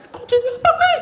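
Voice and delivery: very high loud squeaky voice; squeaky mock voice. Mother-in-law still talking, very high loud squeaky mock voice. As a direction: parody her endless chatter.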